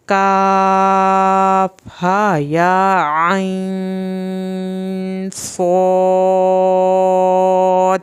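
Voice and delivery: "کاف ہا یا عین صاد" very slowly with long level held vowels; tajweed recitation. Shown as a demonstration. Teacher reciting the whole Quranic verse for students, elongated madd. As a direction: chant each letter name on a sustained tone. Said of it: "مد واجب بھی properly ادا کرنی ہے".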